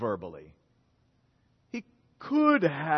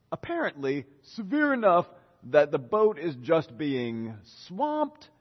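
normal talking voice

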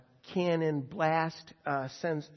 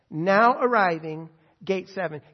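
airport announcement; 12 dB louder than usual. Shown as a demonstration. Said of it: "I love that term".

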